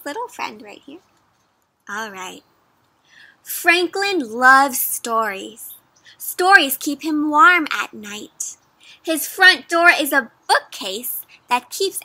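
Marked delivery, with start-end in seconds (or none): 3.4-8.6 storybook read-aloud. A narrator's reading. Reads story text aloud.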